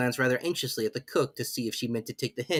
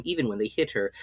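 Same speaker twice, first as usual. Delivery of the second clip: ordinary delivery, nothing marked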